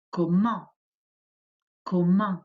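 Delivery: "comment" with silent t and nasal vowel; French word comment. Shown as a demonstration. Teacher modelling "comment" for the class to repeat. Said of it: "Repeat after me".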